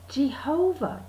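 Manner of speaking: drawn-out JEHOVAH, leaning on the middle; Witness-style Jehovah. Retold or imitated. Comic mimicking how Witnesses pronounce it, weighty. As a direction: say Jehovah with three weighty syllables. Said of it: "the way that witnesses like to pronounce it".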